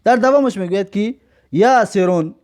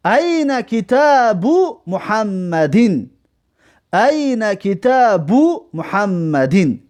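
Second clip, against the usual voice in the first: very slow, each Arabic word spaced out; teacher's slow Arabic dictation; slow model reading; 3 semitones higher, much slower than usual. Shown as a demonstration. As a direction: read the sentence slowly for students to copy. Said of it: "کجاست کتاب محمد".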